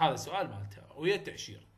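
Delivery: normal talking voice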